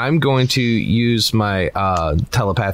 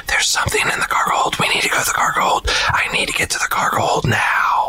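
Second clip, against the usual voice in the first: breathy whisper of a voice inside his head; telepathic whisper. Acted. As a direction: whisper it like a voice haunting his thoughts. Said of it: "kind of whisper in his head".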